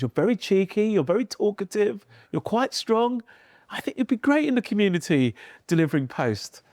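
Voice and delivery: teacher listing reasons. Retold or imitated. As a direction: tick off his traits one by one. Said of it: "She goes".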